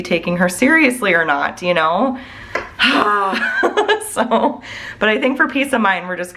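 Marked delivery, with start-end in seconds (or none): 2.5-4.1 weary sighing laugh. Real, not acted. Weary sigh after worried rant.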